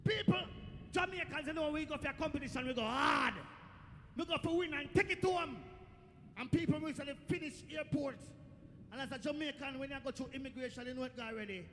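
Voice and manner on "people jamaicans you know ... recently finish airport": loud, punchy crowd hype; rousing boast. Real, not acted. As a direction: punch out each phrase for the crowd. Boast that Jamaicans go hard.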